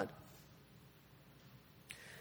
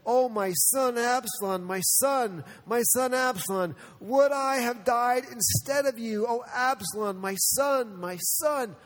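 brokenhearted lament; 5 semitones higher than usual. Retold or imitated. Preacher voices King David mourning Absalom, raised grieving lament. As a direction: wail for your lost son, raised and anguished.

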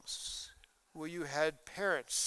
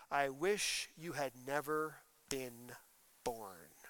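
quiet bitter curse; 4 semitones lower, 6 dB quieter, slower than usual. Acted. Paraphrasing father's curse.

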